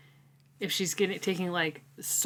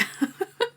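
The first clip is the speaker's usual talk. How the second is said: high giggle; 14 semitones higher than usual. Real, not acted. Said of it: "I like the dog".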